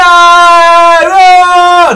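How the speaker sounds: high, loud yelp; sudden shouted oh; loud outburst. Acted. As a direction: blurt it out at full volume. Sudden outburst after intro.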